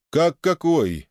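high-pitched mock astonishment; feigned surprise. A narrator's reading. Narrator answering the host through the window, feigned surprise. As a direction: act surprised, pitch jumping up. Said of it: "делано удивился я".

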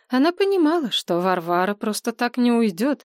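plain reading voice

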